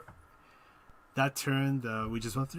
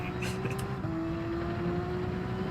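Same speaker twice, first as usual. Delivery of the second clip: high airy laugh; 4 dB quieter than usual. Real, not acted. Laughs at own joke.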